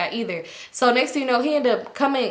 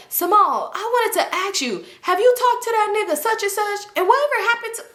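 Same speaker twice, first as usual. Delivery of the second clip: mock pushy classmate; 10 semitones higher than usual. Boy rambling about old school.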